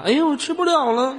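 high, light dainty whine; a quick cutesy girl imitation. Shown as a demonstration. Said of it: "那你模仿一下".

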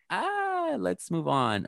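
soft, very high-pitched mock deflection; mock-awkward, high little "ah" and move along; flustered brush-off. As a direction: imitate someone quietly squeaking past an awkward moment. Retold mother brushing off criticism.

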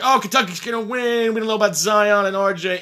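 high, loud mocking pundit voice. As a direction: ham up the smug commentators. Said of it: "They're like".

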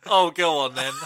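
acted-out eager surrender; playful giving in. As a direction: give in gladly to temptation. Acts giving in to tempting offer.

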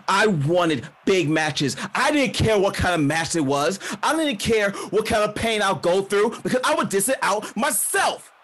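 angry promo climbing in pitch; building anger. Acted. Wrestler tells the crowd what she wanted, anger building.

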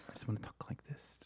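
very soft radio whisper; hushed NPR voice. Shown as a demonstration. Host demonstrates an NPR feel to co-host, whispering. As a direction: whisper it close like public radio. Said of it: "I try to get, like, an N P R feel for this".

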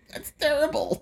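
high, exaggerated counselor voice; mock sympathetic. Caseworker mocking her own sympathetic reply to a client, high-pitched. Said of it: "I'm like".

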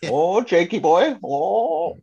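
high, slow put-on voice of a folksy old man; a slow, high cartoon country voice; mock folksy voice. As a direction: drawl it out like a hammy backwoods character. Mock accent character voice.

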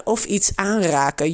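ordinary talk

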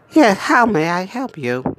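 mock Alexa voice.

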